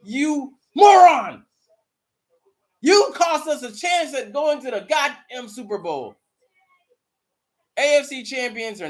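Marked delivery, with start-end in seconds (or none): 0.0-6.2 high, loud furious yell; hammed-up locker room tirade; chewing him out. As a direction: ream him out like an angry teammate. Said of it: "I would chew him out, saying".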